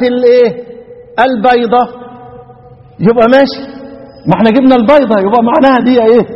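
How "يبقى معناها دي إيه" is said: ordinary talk, nothing marked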